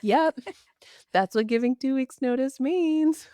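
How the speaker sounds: high, mock-cheery sarcasm; playfully sarcastic retort; sarcastic reply. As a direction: toss it off with a bright sarcastic edge.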